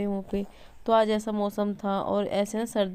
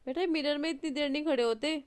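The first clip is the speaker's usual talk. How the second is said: mild scolding; 5 semitones higher, 4 dB quieter than usual. Real, not acted. Mother scolds daughter at mirror.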